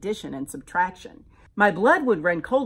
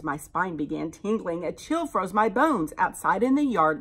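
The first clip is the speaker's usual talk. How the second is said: usual speaking voice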